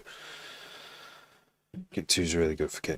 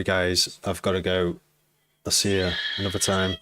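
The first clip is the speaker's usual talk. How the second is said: usual speaking voice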